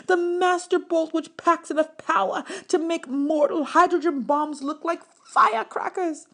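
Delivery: put-on old teacher getting heated about the Master Bolt; getting worked up. A narrator's reading. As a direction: build him up as he gets carried away. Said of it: "getting worked up now".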